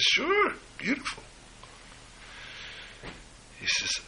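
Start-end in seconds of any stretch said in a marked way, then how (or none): 0.0-1.2 cheerful daughter. Retold or imitated. Daughter answers her father brightly.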